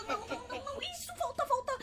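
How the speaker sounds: screaming in fear. Real, not acted. Begging the killer, hushed.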